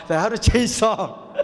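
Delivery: said through a laugh; amused, breaking into laughter. Real, not acted. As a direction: let laughter spill into the words. Laughs at his own funeral story.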